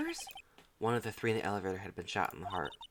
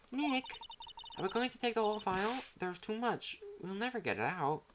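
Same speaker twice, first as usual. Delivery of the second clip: put-on fretful girl sidekick; 12 semitones higher than usual. Sidekick worried about the file.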